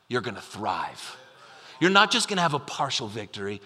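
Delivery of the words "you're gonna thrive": hushed promise. Acted. Preacher's promise to the church.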